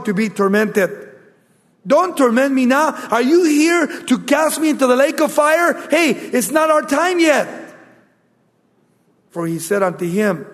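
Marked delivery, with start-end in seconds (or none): 1.9-7.7 high-pitched, acted demon protest; alarmed pleading.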